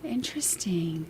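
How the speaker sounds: slow whispered "Interesting". Real, not acted. Streamer musing on a viewer's verdict, whispered. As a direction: draw out "Interesting" in a hushed whisper. Repeats the word under breath.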